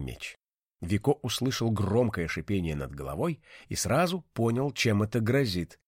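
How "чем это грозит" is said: ordinary delivery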